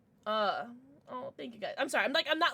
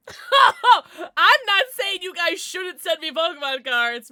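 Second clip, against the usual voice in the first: laughing; 8 semitones higher, 14 dB louder, much slower than usual. Real, not acted. Laughing tipsy outburst.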